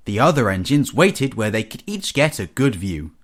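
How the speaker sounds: ordinary delivery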